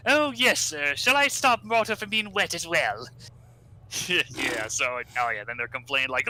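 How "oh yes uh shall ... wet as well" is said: higher, mock-posh sarcasm; sarcastic Orbot. Orbot sassing Robotnik about the machine, lightly voiced sarcastic. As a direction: drip a butler's sarcasm. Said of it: "Orbot's like".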